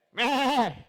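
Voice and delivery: high imitation of a bleating sheep. Shown as a demonstration. Preacher demonstrates the sheep crying at him, imitated bleat. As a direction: let out a mock sheep's bleat. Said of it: "Algo así".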